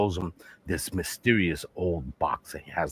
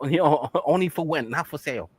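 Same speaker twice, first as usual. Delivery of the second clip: put-on raised-pitch caricatured Chinese accent; mock Chinese accent; 7 semitones higher than usual. Shopkeeper greets a customer, mock Chinese accent. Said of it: "The Chinese man".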